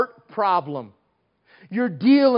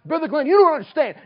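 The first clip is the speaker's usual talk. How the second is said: exasperated protest; 10 semitones higher, 6 dB louder, much faster than usual. Retold or imitated. Imagined complainer's exasperated outburst.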